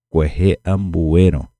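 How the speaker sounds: ordinary delivery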